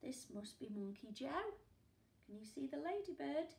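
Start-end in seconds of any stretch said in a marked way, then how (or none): none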